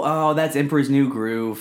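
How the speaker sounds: normal talking voice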